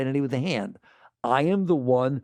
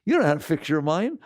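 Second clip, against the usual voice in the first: usual speaking voice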